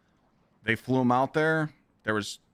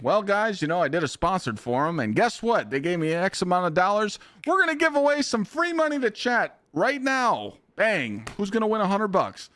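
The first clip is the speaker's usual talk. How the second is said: high, hyped sellout streamer voice; mock sponsored streamer; 8 semitones higher than usual. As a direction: parody a paid shill hyping a giveaway. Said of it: "I'll say it too".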